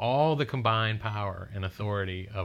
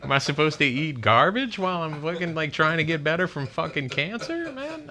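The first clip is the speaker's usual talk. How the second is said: exasperated rant; 6 semitones higher, 4 dB louder than usual. Retold or imitated. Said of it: "And I'm like".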